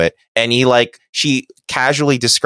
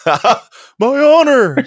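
long drawn-out high mock cry of honor; mock yell; 3 dB louder, much slower than usual. Friend voicing Worf falling, drawn-out high mock yell. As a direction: ham it up like a falling warrior. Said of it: "he yelled as he fell".

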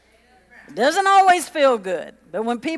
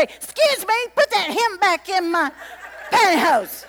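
high, prim, mock-offended voice; prissy lady; 8 semitones higher than usual. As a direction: play the outraged matron, pitched up and prissy. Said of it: "She's not gonna say".

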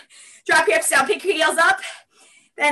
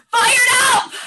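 high, full-voiced workout cry; rallying shout; 6 semitones higher than usual. Shown as a demonstration. Instructor cues the class to yell their word, rallying shout. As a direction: call it out high to rouse them. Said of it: "I'll say".

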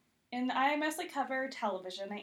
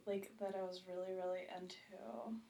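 whispered; 2 semitones lower, 9 dB quieter than usual. Real, not acted. Trails off into a whisper.

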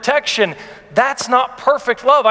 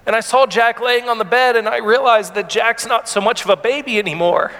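ordinary talk, nothing marked

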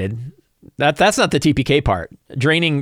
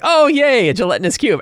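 gleeful; 10 semitones higher than usual. Retold or imitated. Game master recalls rolling a monster.

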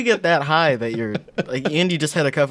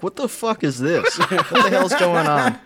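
higher, slower, laughing disbelief; mock baffled staff; 5 semitones higher than usual. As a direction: play the confused counter staff, half laughing. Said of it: "the people behind the counter are like".

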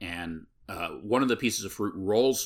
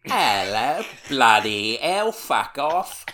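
high, loud mock Cockney kids jeering; mock British accent; 7 semitones higher, 8 dB louder than usual. Rude kids jeering at her in a mock British accent. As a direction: sneer it like rude London kids. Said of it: "They're just like".

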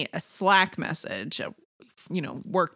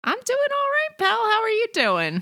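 high cheery voice; 4 dB louder than usual. Pretend. Answers in the cheery cadence.

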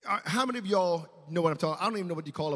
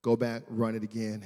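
ordinary delivery

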